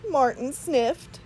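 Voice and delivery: usual speaking voice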